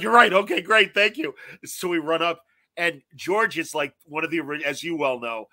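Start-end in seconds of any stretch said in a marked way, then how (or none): 0.0-1.3 eager friend. Retold or imitated. Friend's eager reply retold.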